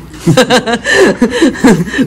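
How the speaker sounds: a short burst of real laughter; bright amused laugh. Real, not acted. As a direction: laugh at your own complaint. Laughing at her own complaint.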